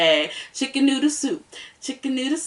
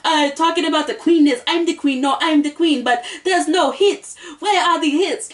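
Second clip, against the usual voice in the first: mock island accent, pitched higher; [name]'s accent; 6 semitones higher than usual. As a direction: quote her accent with higher, sassy lines. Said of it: "[name] has the accent".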